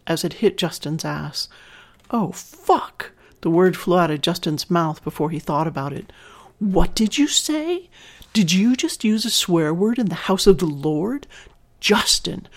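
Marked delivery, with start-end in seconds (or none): 2.1-3.1 a sudden high yelp of pain, caught off guard; startled cry. A narrator's reading. Pain blurts out during paddling. 6.6-12.5 chastising. A narrator's reading. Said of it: "Her tone was chastising".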